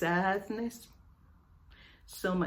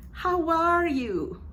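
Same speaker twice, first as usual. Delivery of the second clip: higher, cheerful question; bright greeting; 7 semitones higher, 4 dB louder than usual. Shown as a demonstration. Teacher acting a cheerful friend asking how are you. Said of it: "When you say".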